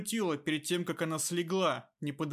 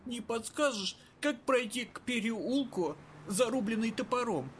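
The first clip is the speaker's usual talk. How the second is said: put-on raspy old woman; 6 semitones higher than usual. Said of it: "обратилась она к Кираю".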